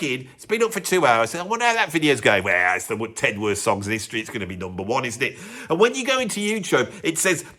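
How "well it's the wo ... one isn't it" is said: low, softly muttered self-talk; smug musing. Acted. YouTuber checking how his video's going, low smug musing. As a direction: drop right down into a private aside.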